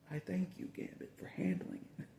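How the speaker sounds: whispered thanks, fading out; grateful whisper. Retold or imitated. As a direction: breathe the thanks almost silently. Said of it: "And I'm like".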